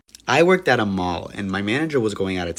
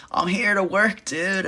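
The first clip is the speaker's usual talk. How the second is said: higher, easygoing dude voice; totally unbothered and chill; mock dude voice; 10 semitones higher, slower than usual. Fired Johnny casually showing up for work, higher easygoing dude voice.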